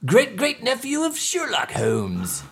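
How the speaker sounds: high, slow, posh theatrical detective; English detective voice. Acted. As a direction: ham up the stage detective. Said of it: "I'm Hemlock Holmes".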